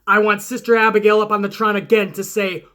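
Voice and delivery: ordinary talk, nothing marked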